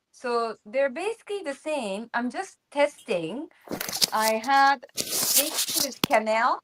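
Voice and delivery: normal talking voice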